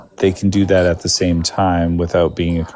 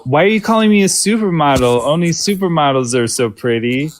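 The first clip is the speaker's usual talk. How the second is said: pitched way up, coy and fluttery; coy supermodel voice; 8 semitones higher than usual. Retold or imitated. A pretty girl coyly deflecting a compliment, high and coquettish. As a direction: play coy fishing for more compliments. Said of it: "And then they go".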